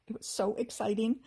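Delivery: plain reading voice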